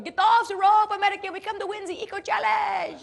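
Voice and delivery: high, loud mock French accent, far above her own voice; bossy French rival shouting up the rope in a French accent. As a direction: lampoon the French captain in a thick French accent.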